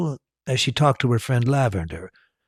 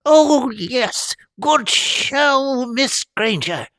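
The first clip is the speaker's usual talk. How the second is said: much higher, excited squeaky delight; gleeful little professor; much slower than usual. A narrator's reading. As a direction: cry out with gleeful praise. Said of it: "cried Professor Flitwick gleefully".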